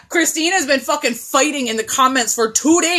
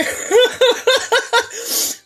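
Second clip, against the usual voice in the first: laugh; 11 semitones higher than usual. Real, not acted. She laughs.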